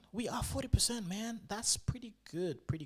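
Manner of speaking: high, loud delighted outburst; excited. Real, not acted. Trader sees his trade jump, high excited outburst. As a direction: burst out happily at the gain. Trade suddenly up forty percent.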